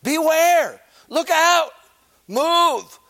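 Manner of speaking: high, loud, frantic yelling; urgent warning shout. Shown as a demonstration. Preacher shows how you really warn someone, shouting high. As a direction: scream the warning at someone in danger. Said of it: "You went like this".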